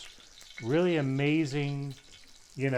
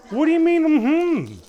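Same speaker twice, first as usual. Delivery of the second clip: high, loud disbelieving cry; outraged disbelief at a shrug; half-mock shocked yell; incredulous; 13 semitones higher, 8 dB louder than usual. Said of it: "I'm like".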